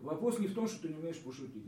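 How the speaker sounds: ordinary talk, nothing marked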